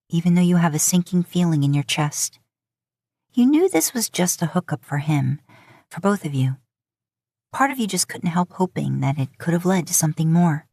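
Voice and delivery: usual speaking voice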